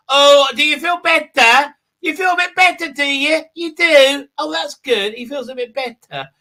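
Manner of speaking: put-on high cooing like to a toddler; mock baby talk. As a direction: coo at him like a nurse to a child. Cooing over the healed hero.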